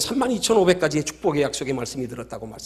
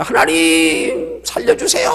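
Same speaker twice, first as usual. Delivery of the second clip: urgent acted cry; 3 dB louder, much slower than usual. Dangling climber begs God to save him, urgent acted cry. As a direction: wail it out in mock desperation. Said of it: "다급하니까".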